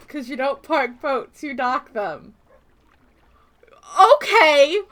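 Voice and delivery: ordinary delivery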